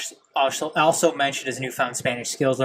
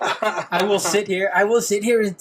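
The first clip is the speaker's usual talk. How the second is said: higher, hammed-up Spanish accent; mock Spanish accent; 6 semitones higher than usual. Shown as a demonstration. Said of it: "I sound like [name] and [name]".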